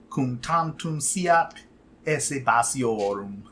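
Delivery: slow, measured recitation of Latin verse; recited Latin. Shown as a demonstration. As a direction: read the poem aloud slowly, in reconstructed Latin. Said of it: "give a sense of what it might have sounded like".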